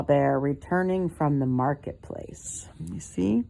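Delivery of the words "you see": usual speaking voice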